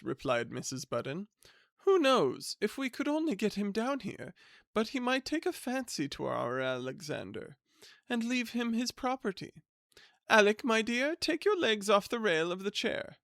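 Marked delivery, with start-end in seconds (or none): 1.8-13.2 high eager wife's voice; Mrs Budden's voice. Acted. Wife urging her husband to write, in a high eager voice. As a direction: pitch it up like an eager, scheming wife.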